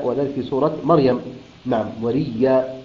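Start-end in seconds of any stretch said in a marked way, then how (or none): 2.0-2.8 وريا drawn out slowly, doubled ya, no stop; slow demo. Shown as a demonstration. Teacher repeats وريا slowly for students. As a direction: stretch وريا gently, wa-riyyā. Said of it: "أبدل الهمزة ياء ثم أدغمها".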